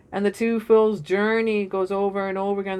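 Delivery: ordinary talk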